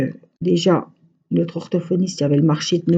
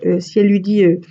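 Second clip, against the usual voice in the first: ordinary delivery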